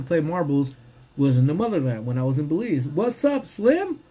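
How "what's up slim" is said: high, cheerful hello; excited greeting. Real, not acted. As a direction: call out to a friend. Greeting viewer joining live.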